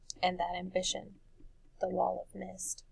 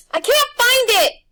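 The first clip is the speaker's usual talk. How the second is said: high, loud, slow desperate shout; desperate cry; 16 dB louder than usual. A narrator's reading. Boy shouting over the beast's shrieks, desperate. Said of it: "Wendell said".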